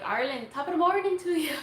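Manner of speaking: put-on playful stock Irish accent, not quite landed. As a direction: toss off a jokey Irish greeting in a half-hearted Irish accent. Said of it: "I need to learn the Irish accent".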